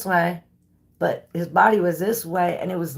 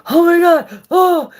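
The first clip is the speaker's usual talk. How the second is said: high, loud, panting panic; out of breath; 11 semitones higher, 10 dB louder than usual. Retold or imitated. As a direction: gasp it out like you ran all the way home. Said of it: "all out of breath".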